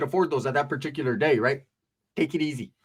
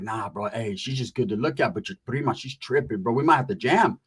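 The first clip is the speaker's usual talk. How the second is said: softer, lower vato voice; low homie; 8 semitones lower, 3 dB quieter than usual. Retold or imitated. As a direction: say it low and sly. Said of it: "he's like".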